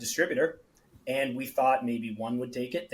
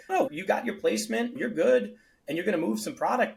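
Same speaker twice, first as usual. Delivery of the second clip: lightly raised, higher voice of a store owner brushing him off; voicing the account owner; 8 semitones higher than usual. Store owner tells the founder sales will die off, higher mock voice. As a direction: play the friendly store owner, pitched up, fast at the end.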